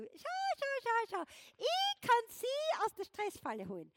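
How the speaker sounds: very high, loud, squeaky excited voice; a Lego set begging to rescue her from stress; eager toy voice. Pretend. Star Wars Lego calling out to her, very high and excited.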